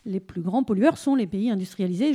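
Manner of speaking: normal talking voice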